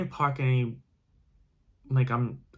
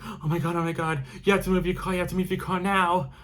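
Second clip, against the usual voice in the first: hysterical; 6 semitones higher than usual. Retold or imitated. Woman in parking lot begs narrator to move car, imitated hysterical pleading. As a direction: mimic a panicked woman begging you to move. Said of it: "She was really hysterical".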